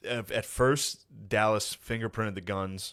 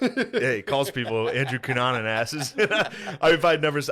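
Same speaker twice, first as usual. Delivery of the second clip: laughing; 4 semitones higher, 6 dB louder, slower than usual. Real, not acted. Laughing while retelling the lyric.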